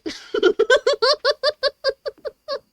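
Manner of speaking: long high-pitched laugh. Real, not acted. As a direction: burst into a high giggle. Laughing at her own point.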